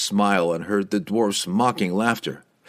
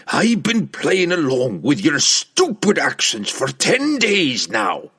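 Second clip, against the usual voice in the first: slow, higher-pitched dwarf's tirade; exasperated dwarf, voice raised; angry scolding; 6 semitones higher, 4 dB louder, slower than usual. A narrator's reading. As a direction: berate him with heated emphasis. Dwarf king berates the barbarian.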